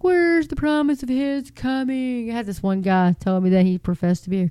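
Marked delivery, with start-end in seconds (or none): 0.0-2.3 high, drawn-out mocking whine; mocking scoffer. Scoffing friends laughing at the believer, mocking. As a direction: taunt it like a scoffing friend, pitched up. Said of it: "your friends laughing, like".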